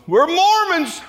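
mock announcement. Students to onlookers on the overpass, high mock shout. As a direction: call it out to a crowd, hammed-up and high. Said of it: "we go".

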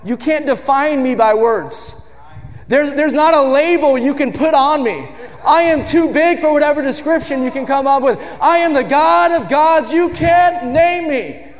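shouted sermon climax; full-force preaching. Real, not acted. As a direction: preach God's greatness to the rafters.

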